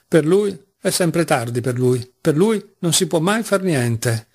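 ordinary delivery, nothing marked

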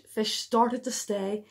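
ordinary delivery

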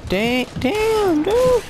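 very high, drawn-out falsetto; high squeal. Acted. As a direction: squeak it out in comic shock. Startled reaction during gameplay.

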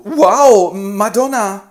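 high, loud amazed gasp of wow. Acted. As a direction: gush with sudden awe. Federigo amazed.

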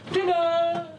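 high sing-song call; calling kids to dinner. Acted.

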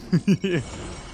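small laugh to oneself; short chuckle. Real, not acted. Player laughing at his own put-on accent, amused chuckle. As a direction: chuckle at your own silliness.

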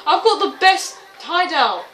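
loud, high, drawn-out bragging; excited boast. Real, not acted. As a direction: show off the find with slow emphasis. Boasts about hiding spot.

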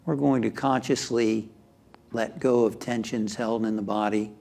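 measured, calming delivery that settles at phrase ends; guided meditation. Acted. Leads a guided relaxation.

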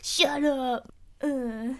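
a hammed-up angry brush-off; mock angry retort. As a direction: bark it back like a sulky tough guy. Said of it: "since he's mad, he's like".

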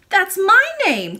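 put-on high, loud, drawn-out child's exclamation; astonished cry. A narrator's reading. Princess hears the lady shares her name, crying out. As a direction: cry out as a surprised little princess. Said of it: "cried the princess".

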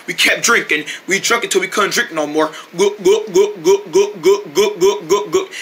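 usual speaking voice

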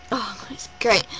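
breathless whisper of delight; real hushed whisper. Real, not acted. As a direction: whisper it like a secret joy. Whispered aside while recalling the gig.

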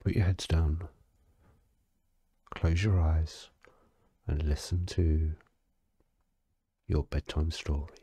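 ordinary talk

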